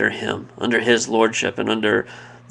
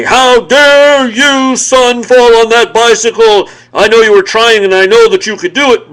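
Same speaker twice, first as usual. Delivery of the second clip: much higher and louder, hammed-up scolding dad; mock scolding father; 11 semitones higher, 11 dB louder than usual. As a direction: yell it like a dad wagging a finger at his fallen son. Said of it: "put my finger in his face and say".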